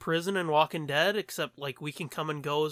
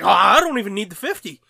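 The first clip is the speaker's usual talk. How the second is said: mock eager friend; 4 semitones higher, 12 dB louder than usual. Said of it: "You're like".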